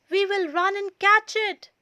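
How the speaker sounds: rally a friend to run; urgent eager call. A narrator's reading. Frog urging a chase.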